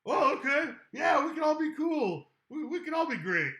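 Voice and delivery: high, loud rush of relief; relieved excited crowd. Retold or imitated. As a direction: shout it like a crowd suddenly let in. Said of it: "And they're all just like".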